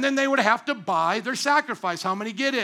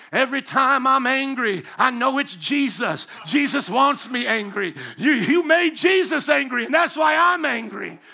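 loud, higher self-righteous shouting; hammed-up rant of someone sure Jesus backs their anger; mock angry zealot; 3 semitones higher, 6 dB louder than usual. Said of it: "the other ditch is".

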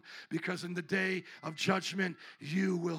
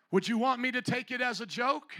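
challenging question; 4 semitones higher, 6 dB louder than usual. Real, not acted. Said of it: "I'm asking a question".

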